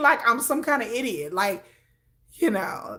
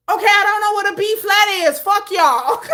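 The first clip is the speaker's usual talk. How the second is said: fed-up retort; 7 semitones higher, 5 dB louder than usual. Retold or imitated. Fed up with family quizzing her.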